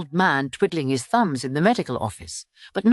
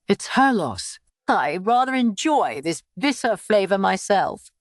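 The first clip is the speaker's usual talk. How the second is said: lightly voiced old father, a touch higher; a calm old man savoring bitterness; father's voice; 4 semitones higher than usual. A narrator's reading. Father talking about fish, lightly voiced.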